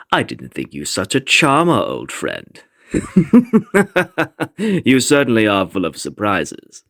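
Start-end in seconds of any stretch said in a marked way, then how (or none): 2.9-4.5 high mocking laugh that falls away; enjoy the surprise with a mocking laugh; amused villain laugh. Villain amused by Vathek's report.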